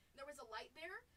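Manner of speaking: ordinary talk, nothing marked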